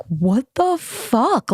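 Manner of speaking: acted-out indignant shock; incredulous. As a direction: stretch every word in stunned outrage. Acts out shock at rejection.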